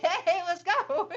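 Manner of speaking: high, loud, drawn-out fitness-coach cheer; crazy Richard Simmons. Retold or imitated. Mom waking her son like a fitness coach, high and slow. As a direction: pump it up like a hyper aerobics coach. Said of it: "like the crazy, uh, Richard Simmons".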